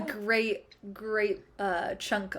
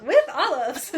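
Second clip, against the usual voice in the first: laughing; 6 semitones higher, slower than usual. Real, not acted. Singing along, laughing.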